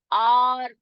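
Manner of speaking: आर as long "aar", h left silent; correct pronunciation. Shown as a demonstration. Teacher repeating the right reading of hour, correct pronunciation. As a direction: stretch आर with no h at the front. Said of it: "तो हम कैसे पढ़ेंगे उसको?".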